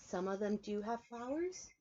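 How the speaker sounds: ordinary delivery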